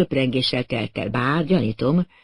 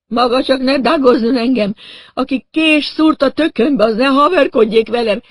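high, loud, wailing outrage; irate protest; 9 semitones higher, 5 dB louder than usual. A narrator's reading. Stabbed man refusing to be called Doug, irate. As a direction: scold at a pitch of pained indignation.

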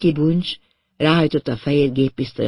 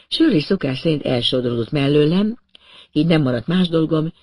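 plain reading voice

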